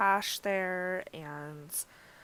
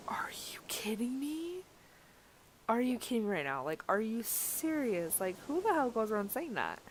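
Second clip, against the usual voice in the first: higher-pitched, disbelieving retold reaction; incredulous retort; 4 semitones higher than usual. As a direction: replay your disbelief at someone's rudeness, pitched up. Retelling her shocked reply to insult.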